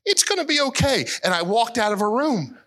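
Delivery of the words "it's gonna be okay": awkward comfort. Retold or imitated. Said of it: "I said".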